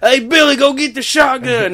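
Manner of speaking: put-on loud, high hillbilly holler in a southern accent. Mocking a southern accent for contrast with the dentist's, hammed-up hillbilly. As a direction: holler it like a broad backwoods Southerner. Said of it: "that fancy southern accent, not like".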